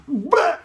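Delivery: loud, high-pitched puking noise; hammed-up imitation of throwing up; mock retching. Girlfriend being sick in the park, loud mock retch.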